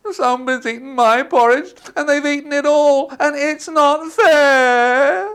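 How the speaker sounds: put-on high, loud whiny child voice; Baby Bear. Baby Bear finding his porridge gone, high whining. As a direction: whine it high like an upset little cub. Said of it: "And Baby Bear said".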